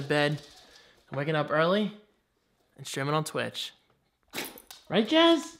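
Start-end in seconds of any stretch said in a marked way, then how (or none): 4.9-5.6 high pet-talk question; high doggy voice. Real, not acted. Asking dog Jazz, high pet-talk voice. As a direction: ask the dog sweetly.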